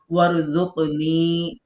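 ওয়ারজুকনি said slowly with the final নি held long; stretched ni. Shown as a demonstration. Teacher models the stretch on the last syllable. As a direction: draw out the নি at the end of ওয়ারজুকনি. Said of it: "নিতে টানবো".

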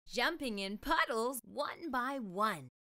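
plain reading voice

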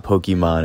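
Pokemon with an EE in the middle; "Pokemon", middle vowel a long ee; said as POKE-ee-mon. Shown as a demonstration. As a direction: say "Pokemon" as poh-kee-mon. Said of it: "it's not Pokemon".

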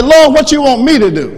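called out high and loud; loud pulpit plea. Shown as a demonstration. Preacher leading the congregation to say it, loud. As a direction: lead the church in the plea. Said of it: "Let's practice. Say".

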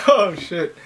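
usual speaking voice

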